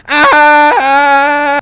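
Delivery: high, loud drawn-out "aah" scream; imitated long scream. Reviewer imitating a host in a speeding car, long scream. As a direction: scream it out and hold the note. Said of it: "he's just screaming".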